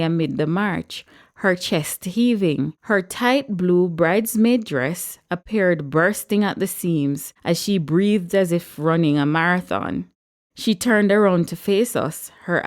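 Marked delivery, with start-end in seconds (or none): none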